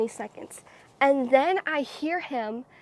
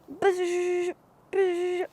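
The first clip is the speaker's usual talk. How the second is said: buzzing phone, higher-pitched hum; phone buzz imitation; 5 semitones higher than usual. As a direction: make a drawn-out vibrating phone noise. Imitating phone buzzing as call comes.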